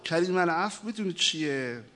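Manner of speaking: ordinary delivery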